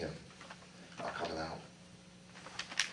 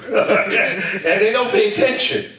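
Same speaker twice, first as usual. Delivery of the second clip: laughing; 11 semitones higher, 10 dB louder, slower than usual. Real, not acted. Laughs at own story.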